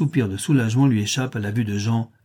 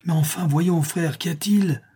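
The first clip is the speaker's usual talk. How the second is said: higher, anxious, shaken question; 6 semitones higher than usual. A narrator's reading. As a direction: ask it with fear rising. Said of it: "d'une voix altérée".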